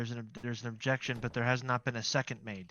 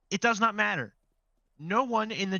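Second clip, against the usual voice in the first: higher, louder firm rebuke; stern raised voice; 8 semitones higher, 5 dB louder than usual. Real, not acted. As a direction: put your foot down as chair. Chair rebukes the chamber.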